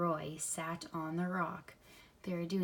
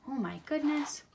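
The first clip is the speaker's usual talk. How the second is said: excited; 6 semitones higher than usual. Real, not acted. Reader thrilled egg hatches.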